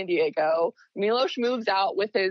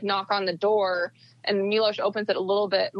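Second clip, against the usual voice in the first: ordinary delivery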